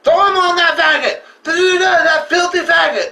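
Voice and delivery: high taunting yell; mocking yell. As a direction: jeer it like a thug hyping the crew. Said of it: "the leader of that fucking group is like".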